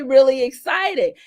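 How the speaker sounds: ordinary talk, nothing marked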